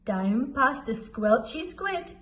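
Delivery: plain reading voice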